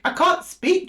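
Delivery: startled outburst. Real, not acted. Reader to Gemini, shocked she cannot speak, high exclamation. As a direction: burst out in disbelief at losing words.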